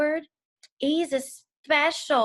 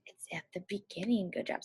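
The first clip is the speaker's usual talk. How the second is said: whispering; 3 semitones lower, 11 dB quieter, faster than usual. Real, not acted. Praise to young kids.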